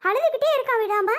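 put-on high, agitated scolding over the baby's crying; angry yelling. Selvi scolds Soni to check the crying baby, yelling angrily. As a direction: raise the pitch and scold urgently. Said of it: "ஏன் கத்துற செல்வி?".

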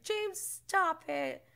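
high, slow, drawn-out reprimand; a put-on voice telling James off; mock prissy warning; prim scolding. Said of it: "Lisa's just like".

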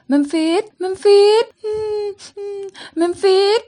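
weeping with relief at seeing him; tearful crying. Acted. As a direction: cry his name through tears. Carol cries to Memphis.

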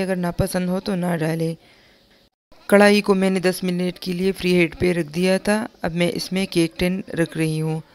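ordinary talk

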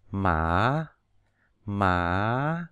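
slow rising "mã"; Mandarin second tone. Shown as a demonstration. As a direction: slide "mã" upward. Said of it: "từ thấp lên cao".

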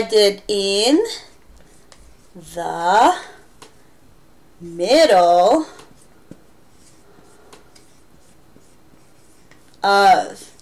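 each word drawn out slowly with long gaps; paced like writing on the board; slow, spaced out. Shown as a demonstration. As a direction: spell it out slowly as you write. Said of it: "I write it this way".

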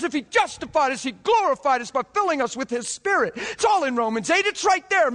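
fired-up revival preacher; preaching at full force. Acted. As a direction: preach it out with growing fire.

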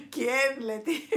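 asking through laughter; giggling while reading a comment; laughing. Real, not acted. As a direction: laugh out the question.

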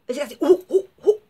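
loud repeated uh of revulsion; disgusted grunts. Real, not acted. Vlogger reacting to an actor she dislikes, disgusted grunts. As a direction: shudder out uh, uh in disgust.

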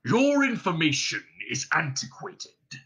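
curt, sharp dragon retort; dragon snapping. Acted. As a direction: snap it back, offended. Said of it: "he snapped".

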